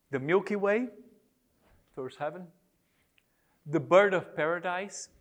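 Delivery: unhurried recitation with long pauses; slow poem reading. Shown as a demonstration. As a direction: offer each image separately for reflection. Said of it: "I'm gonna read them slowly".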